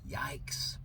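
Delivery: breathy mock-scared whisper; whispered yikes. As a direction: hush it like you're spooked. Mock fearful aside.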